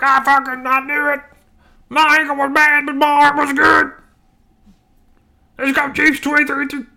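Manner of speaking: mocking Mahomes impression. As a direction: sneer out a smug post-game brag in a squeaky put-on voice. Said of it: "hateful Mahomes impersonation".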